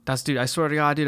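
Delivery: ordinary delivery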